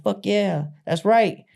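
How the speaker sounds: high, loud hearty agreement; mock enthusiastic. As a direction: cheer it on like a bro. Coworkers cheer the joke.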